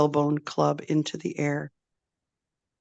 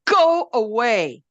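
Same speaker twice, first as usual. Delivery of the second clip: slow, loud, higher growl; grumpy tree; 6 semitones higher, 5 dB louder, slower than usual. A narrator's reading. Blackberry refusing Wolf's help, slow loud growl. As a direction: drag the words out to push him away. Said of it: "And he said".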